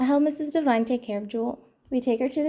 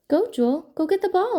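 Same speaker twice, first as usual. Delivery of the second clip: higher-pitched, lightly raised call; mild storybook yell; 7 semitones higher, 3 dB louder than usual. A narrator's reading. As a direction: call out eagerly without really shouting. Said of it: "I yell".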